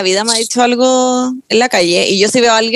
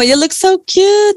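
high, slow gushing voice; mock gushing compliment; 8 semitones higher, much slower than usual. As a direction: coo it like a gushing stranger. Imitating people giving compliments.

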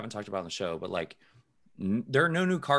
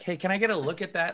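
higher, fast, eager ask as a hopeful buyer; imitating a keen buyer, quick and pitched up; 9 semitones higher, faster than usual. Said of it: "You're like".

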